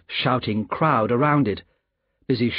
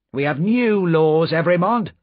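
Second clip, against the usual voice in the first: sharp official; 5 semitones higher, 4 dB louder than usual. A narrator's reading. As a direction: lay down the law sharply. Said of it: "said the official sharply".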